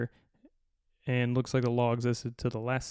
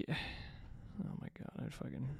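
exasperated sigh; 16 dB quieter, slower than usual. Real, not acted. Exasperated by the list.

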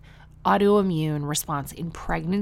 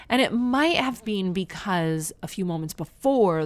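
ordinary talk

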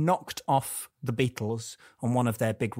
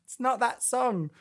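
diva voice; 11 semitones higher than usual. Pretend. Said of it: "I'll be [name]".

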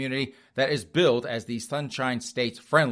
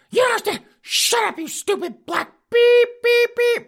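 high falsetto yelling, louder, bleeped insults; shrill screaming granny; 8 dB louder than usual. Retold or imitated. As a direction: scream it in an old lady falsetto. Wife screaming at worker.

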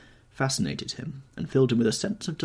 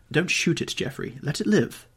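crying out; 8 semitones higher than usual. A narrator's reading. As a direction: beg him to spare the hare. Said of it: "he cried out at once".

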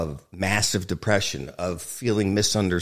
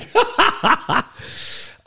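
breathy high burst of laughter; amused laugh after a joking answer; high unvoiced laugh; 4 dB louder than usual. Real, not acted. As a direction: laugh at your own joke.

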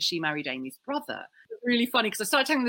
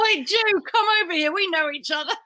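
[name]; 12 semitones higher, 6 dB louder than usual. Retold or imitated. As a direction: call out like a brash London party girl.

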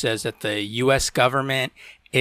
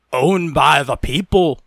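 slow, loud, higher-pitched proclamation; proclaiming protester; 4 semitones higher, 7 dB louder, slower than usual. Retold or imitated. Sovereign citizens claiming a Scottish castle, proclaiming. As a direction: declaim it like a self-styled rebel.

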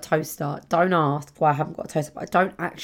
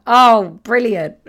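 loud mock-delighted exclaim; booming fake enthusiasm; 5 semitones higher, 13 dB louder, much slower than usual. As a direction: burst out overly thrilled. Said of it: "He was like".

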